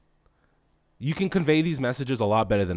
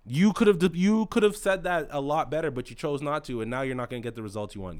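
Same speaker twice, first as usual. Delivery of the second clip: ordinary delivery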